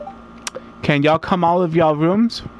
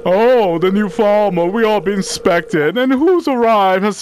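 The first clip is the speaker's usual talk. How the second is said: Elliot voice. Acted. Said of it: "killing them with the voices".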